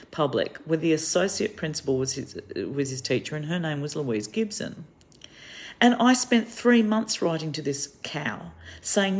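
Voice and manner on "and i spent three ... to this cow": scornful aside. Real, not acted. Scornful aside about the teacher.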